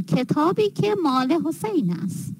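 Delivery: ordinary delivery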